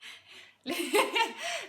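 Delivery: high, loud burst of laughter. Acted. Telling about Lucy and teenage years, high laugh. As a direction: laugh out loud before carrying on.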